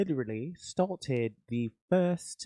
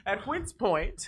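laughing mock archaic; 6 semitones higher, 4 dB quieter, much slower than usual. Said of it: "This man".

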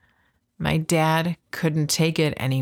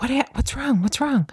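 raised-pitch worried "what's wrong"; alarmed question; 8 semitones higher than usual. Retold or imitated. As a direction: ask quickly, suddenly worried. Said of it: "And I'm like".

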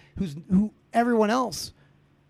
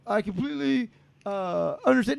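mock sympathetic rep; 3 semitones higher than usual. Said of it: "When they sit there and go".

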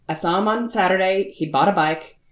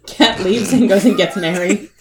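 usual speaking voice

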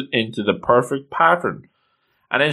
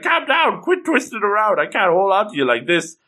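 higher-pitched, exasperated mock plea; flustered scolding; 8 semitones higher than usual. Quifrey struggles to hold wriggling Coco, flustered and high-pitched. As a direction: act out a flustered order, pitch jumping high at the start.

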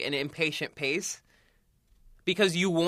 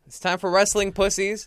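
hyped announcement; 5 semitones higher, 5 dB louder than usual. Real, not acted. Hyping the wrestling segment.

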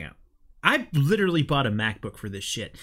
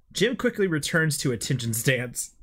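ordinary delivery

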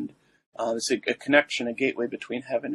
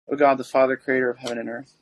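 prayer; 4 dB louder, faster than usual. Acted. Opens the litany.

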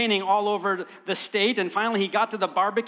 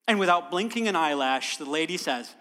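ordinary talk

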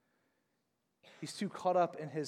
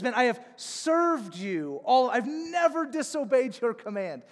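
loud, higher resentful shout; angry complaint; 8 semitones higher, 8 dB louder than usual. Acted. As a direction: snap it at the father like a wronged son. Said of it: "Notice how the older son talks".

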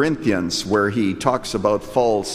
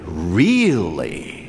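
incredulous; 6 semitones lower than usual. Pretend. Prompts everybody in mock disbelief.

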